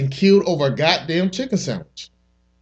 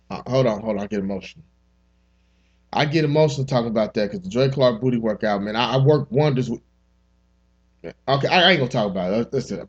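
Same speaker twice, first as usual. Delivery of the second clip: lower, softer, mock choked-up; mock emotional; 6 semitones lower, 3 dB quieter than usual. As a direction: pretend to get teary over a product.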